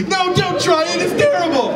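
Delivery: high, loud pleading cry; imitating a worried girl yelling; alarmed warning. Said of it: "this girl's really concerned".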